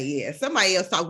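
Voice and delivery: normal talking voice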